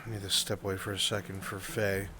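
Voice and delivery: ordinary talk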